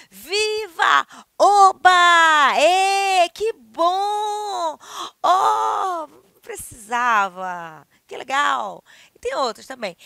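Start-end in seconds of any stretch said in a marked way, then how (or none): 0.1-8.8 high loud happy shouts; joyful cheers. Shown as a demonstration. Teacher demos joy, loud high cheers. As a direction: cheer with delight. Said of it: "Quando você tá alegria".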